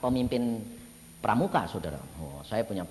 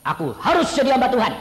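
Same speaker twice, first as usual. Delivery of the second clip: defiant retort; 9 semitones higher, 10 dB louder than usual. Retold or imitated. Son defies father, retold.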